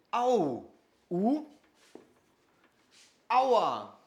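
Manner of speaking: "aua" as a demonstrated cry of pain, drawn out; German ouch. Shown as a demonstration. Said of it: "si on fait mal". (« aua », petit cri de douleur allemand imité)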